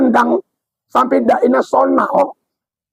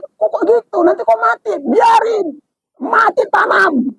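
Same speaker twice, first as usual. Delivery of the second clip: mocking exchange; 8 semitones higher than usual. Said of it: "Kata ustadznya".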